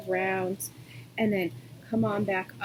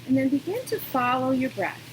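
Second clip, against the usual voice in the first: calm, unhurried yoga-teacher voice with long pauses; guided meditation. Real, not acted. Yoga teacher settling students into breathing, calm guidance.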